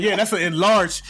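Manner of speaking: imitating relatives laughing off the cheap ring; aunt and uncle scoffing. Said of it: "they're like".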